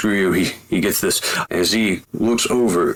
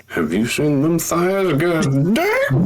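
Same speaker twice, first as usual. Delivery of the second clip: lusty exclamation; 9 semitones higher, slower than usual. Acted. Internal lusty aside.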